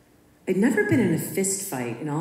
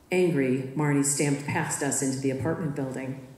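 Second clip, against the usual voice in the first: ordinary delivery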